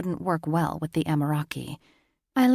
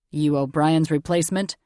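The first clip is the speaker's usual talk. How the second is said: gruff uncle questioning; 5 dB louder than usual. A narrator's reading.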